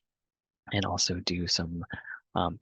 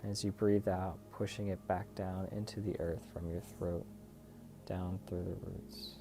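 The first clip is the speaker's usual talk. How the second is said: guided meditation; 9 dB quieter than usual. Real, not acted. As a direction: lead them gently through the breathing, unhurried.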